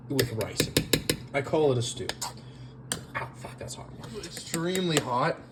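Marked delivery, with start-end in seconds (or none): none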